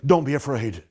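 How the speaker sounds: God quietly reassuring his people; calm voice of God. Acted. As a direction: speak it gently as God comforting. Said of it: "the word of the Lord is".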